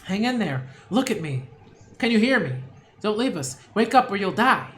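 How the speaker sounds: frantic shouts over a dying man; urgent calling out. Acted. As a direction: call out loud, urging him not to die.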